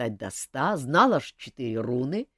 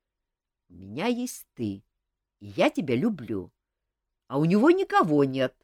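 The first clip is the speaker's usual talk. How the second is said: usual speaking voice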